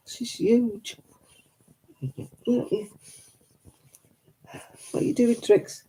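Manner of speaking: high, sing-song cooing at the dog; pet talk. Acted. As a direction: coo sweetly to your pet like a baby. Cooing to her pets.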